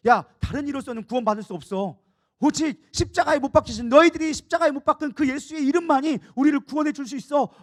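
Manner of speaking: 크게 힘주어 외치는 선포; 종교 지도자들 앞에 성령 충만해 확 치고 나가듯; 담대한 외침. Retold or imitated. 성령 받은 베드로의 담대한 선포. (유대 지도자들에게 맞서는 베드로, 큰 소리로 담대하게)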